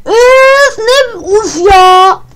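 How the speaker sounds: high, loud, slow fed-up wail; exasperated cry. Real, not acted. Player fed up with the game's wrong guesses, exasperated wail. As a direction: let out all the frustration.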